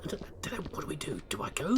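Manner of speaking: hushed, unsure whisper; retelling the wife's uncertainty, whispered. Retold or imitated. Wife unsure, retold.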